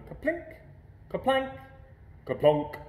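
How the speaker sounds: high plinking kaplink, kaplank; ping the sounds up high like tin; playful high pail plinks; berries plinking in a pail. A narrator's reading.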